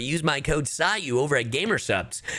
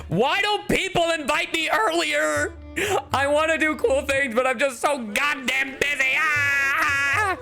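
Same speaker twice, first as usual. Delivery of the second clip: high-pitched hammed-up whine; exaggerated fake-crying complaint; mock wailing. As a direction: wail it like a theatrical tantrum, ending in a long cry. Mock lament over missing an event.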